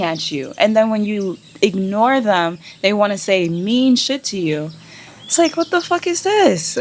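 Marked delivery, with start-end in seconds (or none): 5.2-6.8 high, disbelieving; real exasperation, much higher; exasperated outburst. Real, not acted. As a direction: throw up your hands.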